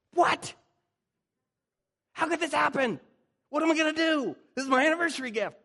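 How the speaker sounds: high, hammed-up panic over a broken gift; mock freak-out. Pastor freaking out over a broken French press, mock panic. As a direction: wail it like the world just ended. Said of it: "I freak out in situations".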